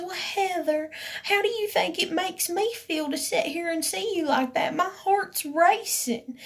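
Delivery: imitated high-pitched pleading, slowing at the end; upset complaint. Woman complaining to Heather about her crying, upset. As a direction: mimic her protest, pitched well up.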